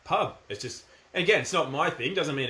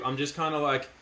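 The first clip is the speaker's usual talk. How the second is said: normal talking voice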